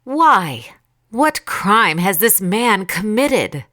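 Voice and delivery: mildly exasperated protest; appealing. A narrator's reading. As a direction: press the crowd with a slow question. Pilate appeals to the crowd again.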